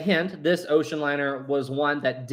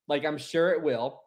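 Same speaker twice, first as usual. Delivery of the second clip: normal talking voice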